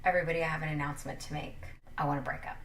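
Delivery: low, quick, quiet delivery; deadpan announcement. Acted. Boyfriend announcing a breakup to the whole family, deadpan and quiet. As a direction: drop it low and rush it out.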